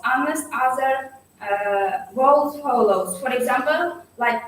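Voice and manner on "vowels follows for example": ordinary delivery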